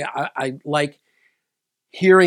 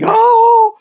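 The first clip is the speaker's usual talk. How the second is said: sudden high, loud cry of shock; shocked shout; 14 dB louder than usual. Retold or imitated. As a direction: yelp it out like you just saw the wreck. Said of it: "I'm like".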